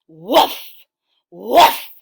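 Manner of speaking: loud, short woof; dog barking. Acted. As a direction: bark it out like an excited dog. Animal noises in read story.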